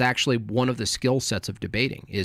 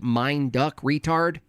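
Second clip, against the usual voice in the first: normal talking voice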